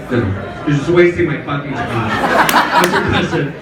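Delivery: normal talking voice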